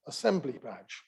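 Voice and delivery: normal talking voice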